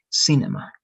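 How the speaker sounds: cinema stressed on CI, the first part; stress on first syllable. Shown as a demonstration. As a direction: say cinema with the accent up front, CI-nema. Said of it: "the stress of this word ... is in the first part".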